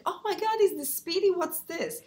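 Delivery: high, amazed gasp of recognition; excited passers-by. Retold or imitated. Strangers on the street spotting the bag, high and excited. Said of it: "people, uh, ask me on the street, like".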